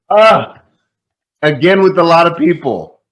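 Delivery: fed-up trainee hearing the same advice again; exasperated groan. Retold or imitated. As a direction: roll your eyes out loud.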